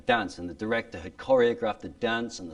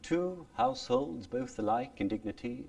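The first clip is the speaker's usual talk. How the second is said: "Two households, both alike in dignity" in Elizabethan original pronunciation, higher and softer; 7 semitones higher, 5 dB quieter than usual. Shown as a demonstration. Teacher contrasting original pronunciation with modern speech. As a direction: recite it in Shakespearean original pronunciation. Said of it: "It's faster too, hey?".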